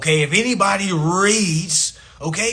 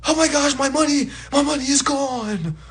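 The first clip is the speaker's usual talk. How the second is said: higher mock fretting over lost money; mock panic; 5 semitones higher than usual. People following society fretting over bank money, lightly mocking. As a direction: whine like you just lost everything, half laughing. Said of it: "you gonna be".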